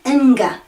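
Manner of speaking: inga as two sounds, ing then ga; hard g in inga. Shown as a demonstration. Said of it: "'cause that's two sounds".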